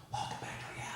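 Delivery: whispered in her ear, unvoiced. Comedian whispering to the praying HR lady. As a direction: lean in and breathe it softly. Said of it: "I leaned in and I said".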